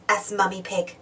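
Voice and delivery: plain reading voice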